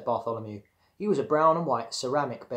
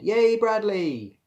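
high, loud, drawn-out cheer; 10 semitones higher, 6 dB louder, much slower than usual. A narrator's reading. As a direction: cheer slowly, stretching the words. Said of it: "They all cheered".

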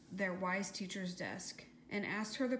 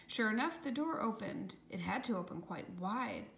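usual speaking voice

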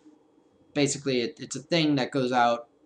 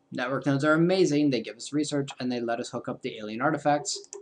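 ordinary delivery

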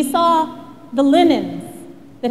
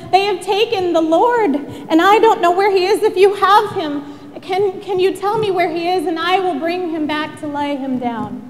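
higher, pressing plea that eases at the end; urgent pleading; 4 semitones higher than usual. Acted. Mary begs the stranger to say where he is, urgent and pleading. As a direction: plead urgently for his return.

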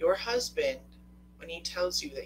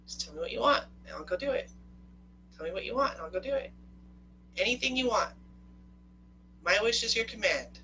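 higher-pitched, obliging husband imitation; eager husband; 6 semitones higher, faster than usual. Husband offering wife anything she wants, eager pleading. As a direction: offer it up readily, pitch lifted.

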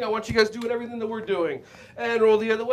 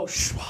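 soft long outbreath; breathing out; 4 dB quieter than usual. Shown as a demonstration. Teacher showing kids how to breathe out. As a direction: blow the breath out. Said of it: "Everyone go".

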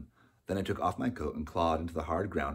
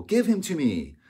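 high, loud desperate demand; crying out; 12 semitones higher, 9 dB louder than usual. A narrator's reading. Opal demanding her baby back, crying out. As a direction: plead at full voice. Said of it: "cried Opal".

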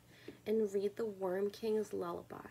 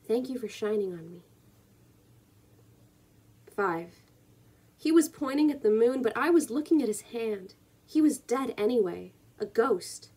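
emphatic poem reading, building louder then settling; poetry reading; 3 semitones higher, 6 dB louder than usual. Acted. Reading the poem's ending to viewers, emphatic poetry reading. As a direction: push the lines out, then ease into the ending.

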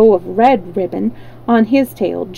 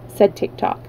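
usual speaking voice

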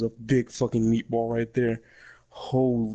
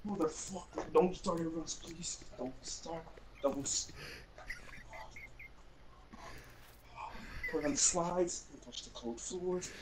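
much softer, tight voice through pain; pained strained pleading; 3 semitones higher, 14 dB quieter than usual. Real, not acted. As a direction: plead under your breath while hurting. Pleading with cramping muscle.

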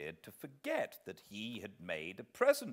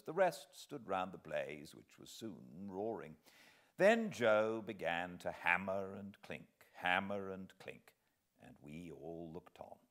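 plain reading voice

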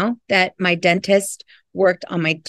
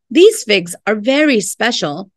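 plain reading voice

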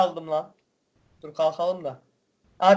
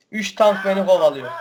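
normal talking voice

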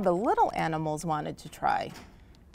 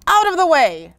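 loud, high command; bossy shout; 10 semitones higher, 10 dB louder than usual. Acted. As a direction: shout to clear everyone aside. Elephant barges in.

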